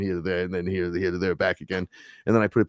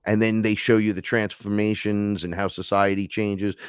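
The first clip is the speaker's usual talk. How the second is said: usual speaking voice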